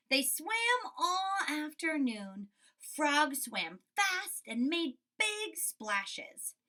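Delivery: lively storybook read-aloud; reading to children. A narrator's reading. Teacher reading Frog and Toad to her class, lively storybook voice. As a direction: read it bright for young kids.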